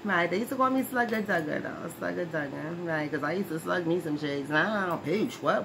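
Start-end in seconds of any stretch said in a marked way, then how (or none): none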